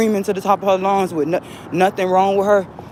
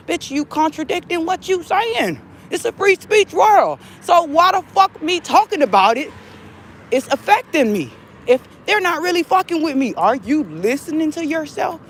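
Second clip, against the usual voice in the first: angry rant; 10 semitones higher than usual. Real, not acted.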